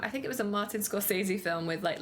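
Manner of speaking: ordinary delivery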